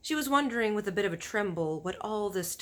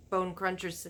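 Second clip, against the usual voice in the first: ordinary delivery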